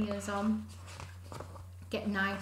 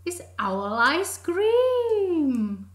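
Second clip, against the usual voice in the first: slow, much higher stretched ice cream; slow punchline; 10 semitones higher, 9 dB louder, much slower than usual. Acted. Mouse drawing out the scary punchline slowly. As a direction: stretch it out for effect.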